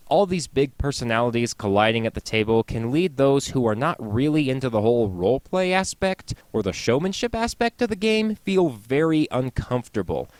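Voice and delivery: ordinary talk, nothing marked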